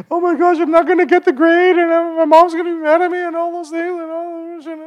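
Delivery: put-on high, anxious child voice; panicky kid voice. Retelling his childhood panic to the congregation, high scared kid voice. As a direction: play your panicked eight-year-old self. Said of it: "and I'm like".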